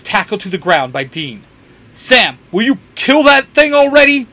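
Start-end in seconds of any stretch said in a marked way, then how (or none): 1.9-4.3 loud, high urgent call; impatient yell. A narrator's reading. Dean calls to his brother mid-fight, impatient yell. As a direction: shout it out, fed up and urgent.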